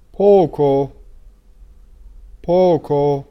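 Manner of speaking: تلفظ پوکو با o خالص; Italian poco. Shown as a demonstration. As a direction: model پوکو with a pure Italian o.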